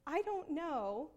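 slow, drawn-out admission; convicted. Retold or imitated. Convicted mom answering her daughter, slow. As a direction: stretch it out slowly, uncertain. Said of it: "I was so convicted".

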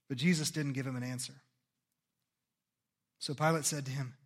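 ordinary talk, nothing marked

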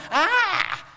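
high-pitched mock scream of alarm; startled demon scream; demon's shriek. Shown as a demonstration. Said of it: "it's more like this demon going".

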